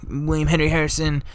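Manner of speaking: hammed-up dying general, fake coughs; dying old man voice. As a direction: play a man gasping his last orders. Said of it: "Anthony Wayne's like".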